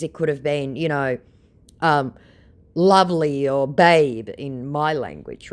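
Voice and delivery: ordinary talk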